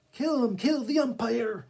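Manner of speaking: high, loud jeering from the stands; angry crowd shout. Acted. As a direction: yell it like a furious fan. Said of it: "shouted some from the stand".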